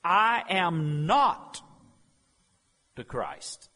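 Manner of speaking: slow, heavy stress on "I am not"; emphatic denial. Acted. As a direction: hammer each word "I am not". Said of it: "He said with tremendous emphasis".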